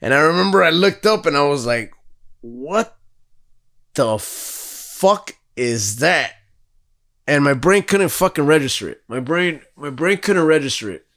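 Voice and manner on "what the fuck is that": slow, drawn-out disbelief; retold stunned disbelief. Teller recalling first sight of floating things, stunned. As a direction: stretch each word in bewildered awe.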